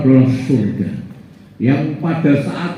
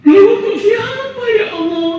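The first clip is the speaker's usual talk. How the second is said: higher, marveling voice of the man; awed questioner. Acted. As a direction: ask God in wonder. Man asks God in wonder. (suara takjub bertanya kepada Allah)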